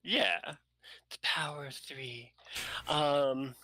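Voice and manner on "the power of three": whispered, dramatic aside. Acted. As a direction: breathe it like a mystic secret.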